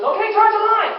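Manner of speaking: high, loud order to charge the line; command. Retold or imitated. Senior man ordering the line charged, high and loud. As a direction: bark the order.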